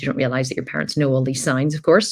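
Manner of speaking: ordinary talk, nothing marked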